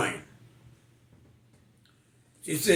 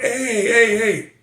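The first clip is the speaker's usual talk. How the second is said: high, louder mock greeting call across the market; 7 semitones higher, 4 dB louder, slower than usual. Market people greeting him, a loud playful call. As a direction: hail him like a market crowd.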